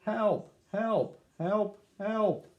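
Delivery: high, panicked calls for help; desperate cries. A narrator's reading. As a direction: yelp from the bottom of the well. Dog sinking in a well.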